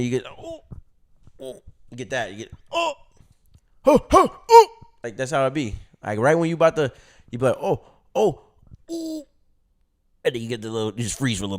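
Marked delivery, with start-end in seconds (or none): 2.3-4.7 high, loud comic moans, oh oh oh; mock moaning. Imitating climax moans. 7.6-9.2 high comic moans rising to a squeak; mock moaning. Imitating climax sounds.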